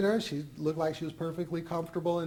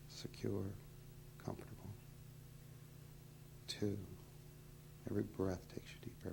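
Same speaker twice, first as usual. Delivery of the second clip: hypnotist induction; 6 semitones lower, 11 dB quieter than usual. Acted. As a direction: whisper them down into trance. Deepening the hypnotic trance.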